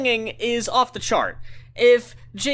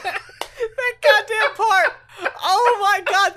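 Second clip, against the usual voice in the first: giddy laughing disbelief; 8 semitones higher, slower than usual. Real, not acted. Overwhelmed by the song.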